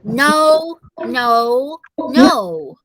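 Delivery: a hammed-up toddler refusal; mild mock holler. A narrator's reading. Fudge hollering no at his mother, mild. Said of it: "he hollered".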